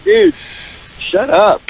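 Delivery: high-pitched mock exasperation; exasperated retort. Retorts to the obnoxious guy.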